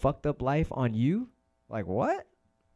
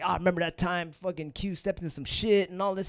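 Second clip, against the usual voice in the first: mocking hater voice; 6 semitones higher than usual. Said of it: "He was talking all".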